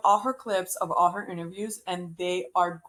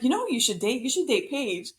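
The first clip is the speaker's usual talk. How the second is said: higher, blunt mock interviewer question; lightly imitating a blunt host, pitched up; 5 semitones higher than usual. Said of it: "but she's so blunt".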